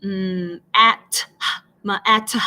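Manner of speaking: "mm, at, ma, at" in separate pieces, T popped on its own; sounding out separately. Shown as a demonstration. Teacher imitating students blending math wrongly, "mm, at, ma, at" sounded out piece by piece. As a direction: break "mm, at, ma, at" apart like a struggling beginning reader. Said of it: "they're trying to sound it out".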